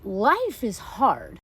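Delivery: usual speaking voice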